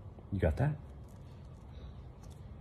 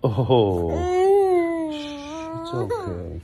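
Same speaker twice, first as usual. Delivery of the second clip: shh, it's okay, gently soothing; 13 semitones higher, much slower than usual. Real, not acted. Comforting crying baby.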